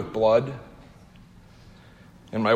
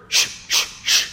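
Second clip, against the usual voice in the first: three sharp voiceless puffs; short hard puffs of effort; imitated labour breathing; 3 dB quieter than usual. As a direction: push through with hissed "shh" breaths. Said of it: "her pants of short, quick breaths".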